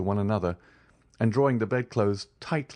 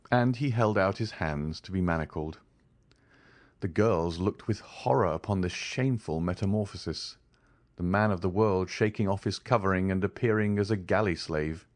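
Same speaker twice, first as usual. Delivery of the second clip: ordinary delivery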